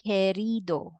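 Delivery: querido said as kerido, silent u, plain k; correct way. Shown as a demonstration. Teacher modeling the right sound for students, querido with a plain k. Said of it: "please repeat after me".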